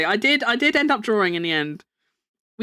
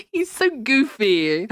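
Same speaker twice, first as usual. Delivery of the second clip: ordinary talk, nothing marked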